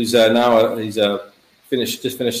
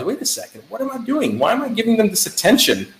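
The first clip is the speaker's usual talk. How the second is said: high-pitched, incredulous self-questioning; sudden realization; 9 semitones higher than usual. Retold or imitated. Father stopping himself mid-fight to question his own anger, sudden realization. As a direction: catch yourself mid-thought, pitch jumping up. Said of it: "then I suddenly realized".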